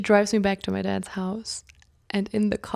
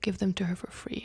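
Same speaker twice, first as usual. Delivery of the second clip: soft unvoiced whisper of a mysterious voice; whispered inner voice; 7 dB quieter than usual. Mysterious inner voice whispering advice to her. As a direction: breathe it like a voice in her head. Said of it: "And then I hear this voice".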